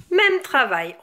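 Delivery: usual speaking voice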